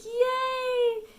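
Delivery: high, loud cheer; excited yay. Retold or imitated. Hearing she got the job, a loud high yay. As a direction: squeal it with pure delight. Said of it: "I was like".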